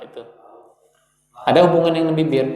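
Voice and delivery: normal talking voice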